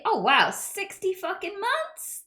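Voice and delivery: high, loud, slow astonishment; amazed. Real, not acted. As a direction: stretch it out in real amazement. Reacts to long subscription.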